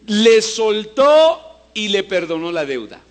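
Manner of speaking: loud and slow proclamation; proclaiming. Acted. Preacher reads the master's mercy to the church, loud and slow. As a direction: ring it out.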